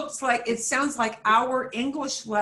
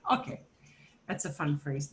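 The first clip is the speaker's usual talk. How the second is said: ordinary delivery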